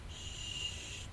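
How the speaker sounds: long, hushing hiss; soft shush. A narrator's reading. Teacher hushes Rika by the window, soft shush. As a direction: shush very softly.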